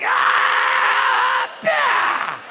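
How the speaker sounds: very high, loud strained yell; long scream bursting into a cry; mock climax scream. Man finally finishing after an hour, strained high scream. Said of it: "it's like".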